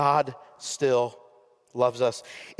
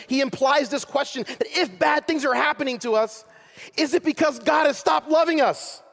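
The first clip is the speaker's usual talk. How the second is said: high, urgent preaching, pressing the question; fervent preaching; 9 semitones higher than usual. Acted. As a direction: push the question on the congregation with rising fervour. Preacher pleads with full force.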